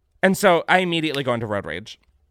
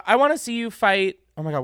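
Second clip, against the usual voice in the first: ordinary delivery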